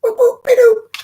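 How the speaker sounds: very high squeaky cartoon tittering and nonsense; cartoon giggle. Cartoon justice closing her welcome, high squeaky giggle. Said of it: "Warner Brothers cartoon voice".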